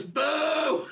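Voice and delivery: high-pitched heckler's boo. Retold or imitated. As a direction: imitate one man starting a boo. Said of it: "He starts to boo Ryan".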